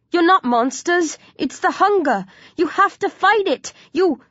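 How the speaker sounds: urgent appeal rising high; insistent plea. A narrator's reading. Hero appealing to zombie gang, urgently pleading. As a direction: urge them to fight the urge.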